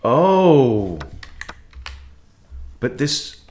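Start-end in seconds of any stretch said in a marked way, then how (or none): 0.0-1.0 loud, high startled "Oh"; surprised oh. Real, not acted. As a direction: caught off guard by a move. Sudden realization, loud high.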